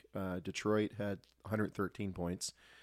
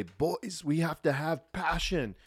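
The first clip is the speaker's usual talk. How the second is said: imitating Teemu's Finnish-accented English, higher; mock Finnish accent, drawn out, pitched up; Teemu's funny accent; 6 semitones higher, slower than usual. Teammate imitating the captain's Finnish accent. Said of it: "he had a funny accent".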